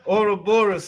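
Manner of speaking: "Ouroboros" as OR-oh-BOR-us, high; clearer pronunciation. Shown as a demonstration. Host correcting guest so he can understand, "Ouroboros" as OR-oh-BOR-us. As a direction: spell out "Ouroboros" in sound as OR-oh-BOR-us. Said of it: "so my brain can understand it".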